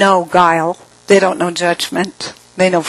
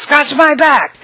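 higher, louder, bossy request; mock the old woman ordering a back scratch; old woman's demand; 8 semitones higher, 4 dB louder than usual. To the narrator, an elderly mentor demands a back scratch, higher and bossy. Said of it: "she goes".

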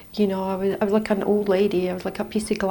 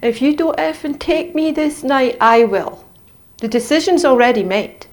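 loud, higher, defiant demand to be taken; reliving a desperate scream; screamed ultimatum; 4 semitones higher, 6 dB louder than usual. Retold or imitated. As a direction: shout the ultimatum at God. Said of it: "and I was screaming".

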